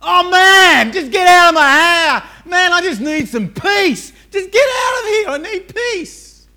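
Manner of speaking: full high-pitched shout, at the end of your tether; exasperated yelling. Acted. Harried parent wanting everyone out of their hair, exasperated shouting. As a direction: yell it out, stretching the last words. Acted harried person demanding peace.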